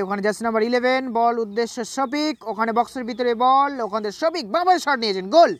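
rising to the goal shout; excited goal call. Acted. Commentator calls a goal.

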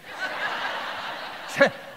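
high breathy chuckle; airy chuckle. Real, not acted. Speaker amused by the shopping bags, airy chuckle. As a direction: let a light airy laugh escape. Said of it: "two bags of shopping".

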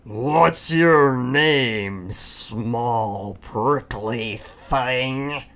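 slow, drawn-out, unkind big-beast voice; unkind rhinoceros. Acted. Rhinoceros mocks the small porcupine, slow and unkind. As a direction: sneer it down at a small thing, slowly. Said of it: "asked the rhinoceros unkindly".

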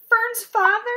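high, loud wail of disbelief; lightly acted sobbing question; sobbed. A narrator's reading. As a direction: cry it out in dismay. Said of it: "sobbed Wilbur".

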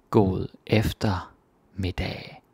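God eftermiddag slowly, each syllable separate; slow demo. Shown as a demonstration. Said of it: "slow demo".

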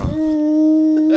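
very high, sustained ringing tone; flashbang ringing. Retold or imitated. Comparing the breakup to a movie flashbang, imitated high ringing. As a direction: imitate ears ringing after a blast. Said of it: "when a flashbang goes off".

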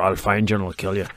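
low, quiet imitated threat; Liam Neeson in Taken. Co-host correcting the film quote, imitated low and quiet. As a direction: drop low and soft like the film hero. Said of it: "it was more".

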